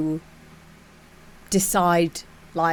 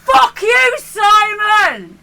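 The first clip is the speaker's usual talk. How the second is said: much higher and louder shouted insult; mock angry heckle; 14 semitones higher, 6 dB louder, much slower than usual. Audience booing Simon Cowell, a high mock shout. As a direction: yell at the judge like a furious crowd.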